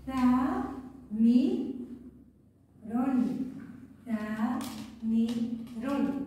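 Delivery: each syllable split and held apart. Shown as a demonstration. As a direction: sound it out one syllable at a time. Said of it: "كلمة هتف". (تقطيع صوتي مقطعًا مقطعًا)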